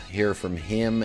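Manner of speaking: ordinary talk, nothing marked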